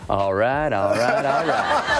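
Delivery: imitating his own drawl; easy Texan drawl; drawled catchphrase. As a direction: lay back and stretch it out. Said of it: "And I go".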